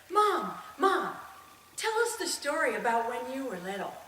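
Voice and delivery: put-on children calling out, then pleading; eager children. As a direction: beg like excited kids wanting a story. Siblings begging mother for a story.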